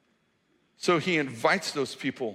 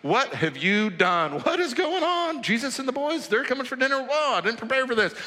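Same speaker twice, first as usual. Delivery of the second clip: high, startled panic; wife panicking over dinner, pitched way up; flustered wife; 9 semitones higher than usual. Pretend. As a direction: caught off guard by surprise guests. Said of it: "sees a rabbi and thinks".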